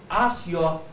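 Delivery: ordinary talk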